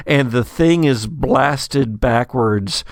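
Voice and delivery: usual speaking voice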